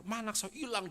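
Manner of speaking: high-pitched mock cry of alarm; mother crying out for her child, without extra volume; retold mother's cry. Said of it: "si ibu langsung teriak-teriak".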